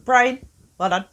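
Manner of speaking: ordinary delivery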